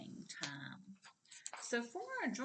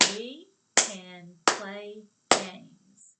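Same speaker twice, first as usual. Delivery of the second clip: each word punched loud and slow; slow loud classroom chant; 14 dB louder, much slower than usual. Shown as a demonstration. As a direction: clap out every word loudly. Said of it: "and clap".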